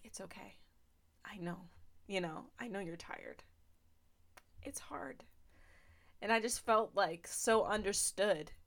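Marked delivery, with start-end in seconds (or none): 0.0-5.2 very soft, hushed reassurance fading to a whisper; softly comforting voice, ending in a whisper; tender comfort. Retold or imitated. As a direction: soothe a tired child gently. God's comfort retold in driveway.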